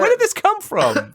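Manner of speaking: very high, baffled outcry; high-pitched what-are-you-doing disbelief; incredulous. Retold or imitated. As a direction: react in mock disbelief. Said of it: "And I was like".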